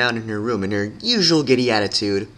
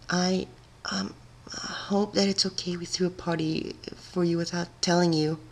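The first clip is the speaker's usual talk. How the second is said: much softer and higher, shy and hesitant; timid apology; 7 semitones higher, 8 dB quieter than usual. A narrator's reading. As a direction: murmur a nervous sorry. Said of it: "Fluttershy kinda apologized".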